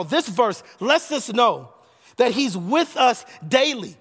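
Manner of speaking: rising, emphatic preaching cadence. Acted. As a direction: build it like a pulpit climax. Preaches his closing climax.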